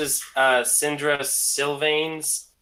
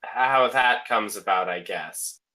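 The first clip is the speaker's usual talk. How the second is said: ordinary talk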